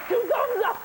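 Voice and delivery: high, laughing critic quote; giddy fake review blurb; laughing mock quote. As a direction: squeal it through laughter. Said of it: "Siskel and Ebert say".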